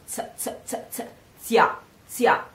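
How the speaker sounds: "z" as a voiceless ts, repeated, then into "zia"; isolated Z sound. Shown as a demonstration. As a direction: drill the crisp ts of "z" and "zia". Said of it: "Try the sound".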